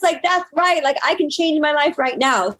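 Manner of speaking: building high, defiant self-affirmation; fired-up declarations rising high, then easing; empowered pep talk. Retold or imitated. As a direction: rev yourself up, pitch climbing to a peak.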